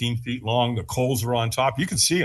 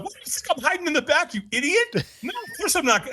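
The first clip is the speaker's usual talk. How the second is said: high-pitched mock outrage; indignant inner thought; 14 semitones higher than usual. Man answers the stranger in his head, mock indignation. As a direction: rush out an incredulous, scoffing retort. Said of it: "I'm thinking to myself".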